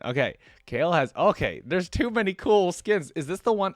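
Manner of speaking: overwhelmed by how many cool skins there are; excited. Real, not acted. Streamer gushing to viewers over the cool skins, excited and loud.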